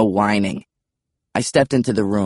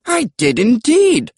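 slow, high British pterodactyl; very British dinosaur, pitched way up; British accent; 14 semitones higher, 4 dB louder, slower than usual. A narrator's reading. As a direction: draw it out in high British tones. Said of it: "British accents were unexpected".

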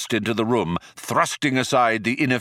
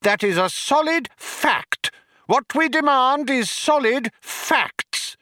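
high-pitched, strident insistence; bawling. A narrator's reading. Younger philosopher bangs the desk, indignant bawling. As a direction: bawl each claim like banging a desk. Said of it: "bawled Vroom Fondle, banging on a nearby desk".

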